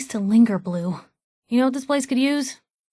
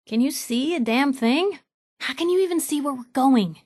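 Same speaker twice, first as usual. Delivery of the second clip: ordinary delivery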